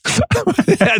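high burst of laughter. Real, not acted. Laughs at the clip.

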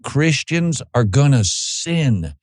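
loud, driving pulpit delivery; full-force preaching. Acted. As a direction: hammer the warning home to the congregation.

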